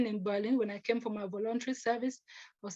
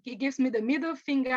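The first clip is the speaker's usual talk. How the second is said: ordinary delivery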